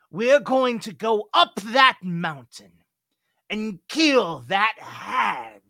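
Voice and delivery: loud, high rallying shout; fiery battle-cry sermon; rousing cult leader. Acted. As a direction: whip the brothers into a fervour, loudly. Cult leader rallies his brothers.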